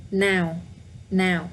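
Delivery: Now said with a falling tone. Shown as a demonstration. Teacher repeating Now with a down tone. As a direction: let Now fall. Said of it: "but with the down tone".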